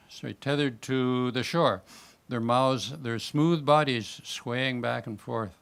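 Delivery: plain reading voice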